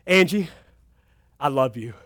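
low, soft acted endearment; tender declaration. Preacher acting out words to his wife, tender. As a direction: softly play the loving husband. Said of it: "I say to my wife".

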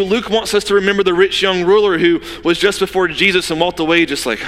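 usual speaking voice

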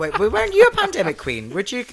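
high, loud shocked challenge; mock accusing gasp. Quizzing a newer queen, high and loud mock outrage.

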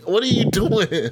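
normal talking voice

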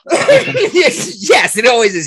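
laughing yes, yes. Real, not acted. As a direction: laugh through it. Laughing agreement.

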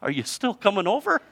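small, high, shaky plea; acted hopeful, tremulous question. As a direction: ask timidly in a high, wavering voice. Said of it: "and then a shaky voice said".

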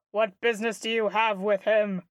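normal talking voice

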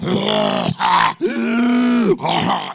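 high, loud wheezing smoker laugh; smoker's laugh. Retold or imitated. Comic imitates waitress laughing in the back, loud wheezy laugh. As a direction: cackle like a smoker who can't breathe. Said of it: "I hear this beautiful laugh".

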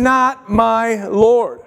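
"not my Lord" drawn out slowly, "not" hit hardest; "not" stressed, the rest falling slowly. Shown as a demonstration. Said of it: "The only way that I can say that Jesus is my Lord".

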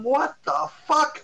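high, slow, disbelieving what the fuck; stunned. Retold or imitated. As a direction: drag it out in shock. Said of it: "I'm going".

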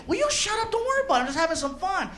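high, annoyed snap back; irritated comeback. Retold or imitated. Driver snapping back at Felipe, high and annoyed. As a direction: brush him off, irritated and high.

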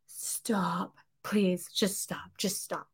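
unvoiced, slow pleading whisper; whispered plea. Tired parents to daughter reading to-do list, whispered plea. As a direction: beg her to stop under your breath.

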